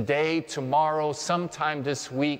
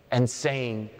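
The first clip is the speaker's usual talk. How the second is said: ordinary talk, nothing marked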